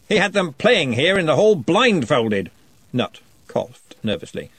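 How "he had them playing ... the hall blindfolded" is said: slow, louder, jovial with an edge; jolly but menacing; threatening joviality. A narrator's reading. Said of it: "slightly threatening joviality".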